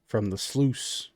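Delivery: low, soft, halting country man; easygoing furbolg host. Acted. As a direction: amble through it, low and quiet. Game master plays Clyde.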